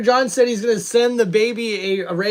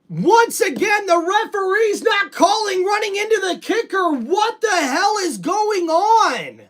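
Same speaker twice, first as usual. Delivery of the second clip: outraged complaint; 7 semitones higher than usual. Real, not acted. Outraged at missed penalty call.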